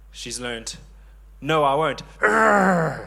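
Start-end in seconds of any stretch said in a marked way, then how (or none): 1.4-3.1 mock toddler refusal ending in a loud, high growl; defiant little daughter. Three-year-old refusing to brush her teeth, loud mock defiance. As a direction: dig in like a stubborn small child, then growl. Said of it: "I don't speak like that".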